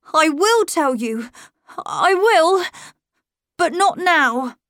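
put-on high, earnest boy's voice, leaning on tell; a small boy promising anything to keep her close; pleading young boy. Miles promising his governess everything, high earnest boy's voice.

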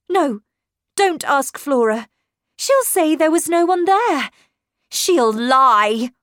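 high, loud, urgent warning; agitated accusation. A narrator's reading. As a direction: warn her off with rising agitation. Governess accuses the child of lying.

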